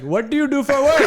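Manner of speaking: mock Indian accent crowd-work question. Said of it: "but in an Indian accent".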